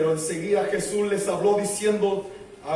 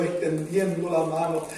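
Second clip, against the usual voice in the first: ordinary talk, nothing marked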